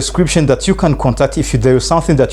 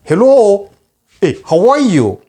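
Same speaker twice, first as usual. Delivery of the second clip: high, lively "hello", "hey", "yo"; casual greetings; 6 semitones higher, slower than usual. Shown as a demonstration. Teacher acting casual greetings from afar, lively. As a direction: wave and call out to a friend at a distance. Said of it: "oh, you say".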